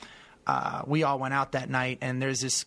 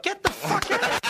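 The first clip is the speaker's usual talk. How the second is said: much higher, amazed disbelief, laughing through it; a hammed-up gasp of disbelief, high and a little louder; mock stunned crowd; 3 dB louder, slower than usual. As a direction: play the crowd that can't believe who just walked out. Crowd stunned at surprise guest.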